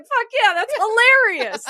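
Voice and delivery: high, loud, laughing cheer; delighted laugh. Retold or imitated. Friend reveals the setup, a high laughing cheer of delight. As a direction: crow with glee at the prank. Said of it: "I was like".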